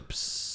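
soft hissing psst sound; a quiet hiss like a stream; piss hiss. Retold or imitated. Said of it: "Every time you piss, it goes".

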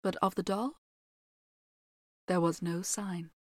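measured fairy tale telling; story narration. A narrator's reading. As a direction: read it like a storyteller by the fire. Reads fairy tale aloud.

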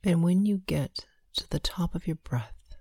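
slow, hushed breath counting. Acted.

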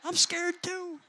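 high-pitched comic confession of fear; mock scared. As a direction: pitch it way up, playing scared for laughs. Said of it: "I'm thinking".

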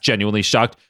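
ordinary delivery

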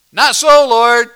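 loud, high protest as Peter; indignant refusal. Acted. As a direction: Peter shouting no to the Lord. Peter refuses the Lord's command.

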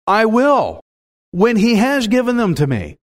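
firm, assured declaration; believer's certainty, no hesitation; confident faith. Acted. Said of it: "You can hear the confidence".

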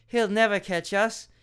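ordinary delivery

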